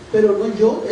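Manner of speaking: usual speaking voice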